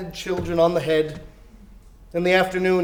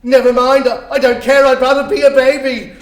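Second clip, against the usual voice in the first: put-on loud, higher, giddy old man's babble; giddy joy; 6 semitones higher, 8 dB louder than usual. Scrooge gushing with joy on Christmas morning, loud and giddy. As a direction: burst out like a delighted child.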